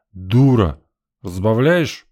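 loud slurred bark at the wife; drunken shout. A narrator's reading.

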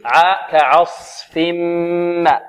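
كعصف with a light ayn, no vowel tilt; tajweed demonstration; slow careful ayn. Shown as a demonstration. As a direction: stretch كعصف slowly. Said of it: "العين مرقة بدون تقليل".

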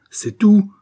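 loud, high threatening question; menacing. A narrator's reading. Martin questioning the man emptying his pockets, menacing. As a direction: demand it with menace. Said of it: "interrogea Martin d'un ton menaçant".

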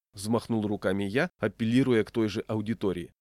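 usual speaking voice

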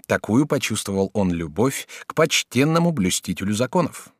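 ordinary delivery

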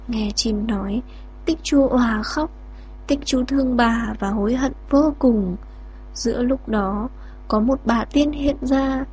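ordinary delivery

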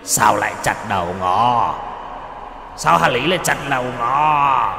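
high, eerie wailing ghost; a dead victim wailing at her killer; ghostly wail. Acted. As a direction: moan like a wronged spirit.

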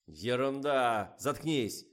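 slow angry howl. A narrator's reading. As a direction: howl it out in fury. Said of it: "взвыл режиссёр".

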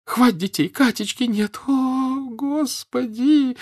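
high tearful cry stretching into a wail; breaking into sobs. A narrator's reading. Mother finds Katechka missing, breaking into sobs. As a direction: let the words dissolve into a long wail. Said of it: "И опять она зарыдала".

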